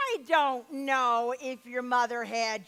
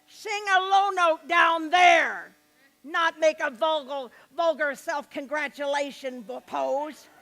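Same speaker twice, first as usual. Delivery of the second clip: scolding shout; 4 dB louder than usual. Retold or imitated. Teacher scolds the bent-over student, shouting. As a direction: bark the correction at him.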